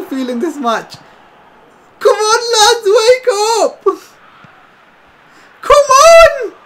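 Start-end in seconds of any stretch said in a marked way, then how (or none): 2.0-3.8 high, strained pleading cry; anguished high plea; desperate cry. Real, not acted. Pleading at his players. 5.6-6.5 very high, loud drawn-out yell; desperate shriek at the screen; anguished scream. Real, not acted. Screaming at match.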